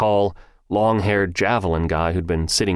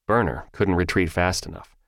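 ordinary delivery

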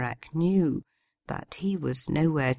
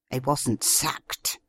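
slow, tight angry hiss; hissed through teeth; slower than usual. A narrator's reading. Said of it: "she hissed through gritted teeth".

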